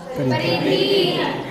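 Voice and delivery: very high, long drawn-out परिधि; stretched high answer. Shown as a demonstration. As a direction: sing the word up high and hold it. Teacher gives class the answer. (ऊँची खींची हुई आवाज़ में परिधि)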